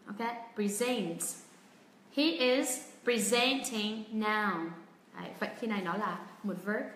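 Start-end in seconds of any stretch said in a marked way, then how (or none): 0.5-4.7 very slow model, "presenting" as pri-ZENT-ing; stress on second syllable. Shown as a demonstration. Teacher puts the verb in a sentence, very slow pri-ZENT-ing. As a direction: spell it out slowly, weight on ZENT in "presenting". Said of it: "trọng âm sẽ rơi vào âm tiết thứ".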